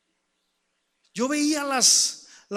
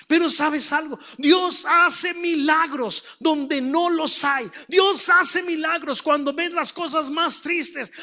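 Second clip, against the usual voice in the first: raised, forceful declarations with drawn-out stresses; impassioned preaching; 5 semitones higher, 5 dB louder than usual. Real, not acted. Preacher proclaiming God's miracles to the congregation, fervent. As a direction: build it higher with each repeated declaration.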